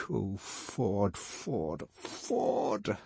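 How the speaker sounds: fading to a whisper; despairing Bernard. Acted. Bernard overhearing talk of Lenina, fading to a whisper. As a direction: sink into hushed despair. Bernard seething, grinding teeth.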